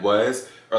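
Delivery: plain reading voice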